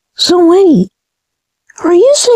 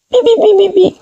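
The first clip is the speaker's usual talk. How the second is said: imitated beeping; 3 semitones higher, much faster than usual. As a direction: beep quickly like a machine. Beeping noises between house names.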